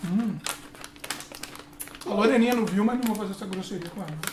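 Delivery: talking while chewing food; muffled through chewed chocolate; mouth full, chewing. Real, not acted. Seen 3: Hand to mouth at -5; cheeks bulge, jaw chewing through words.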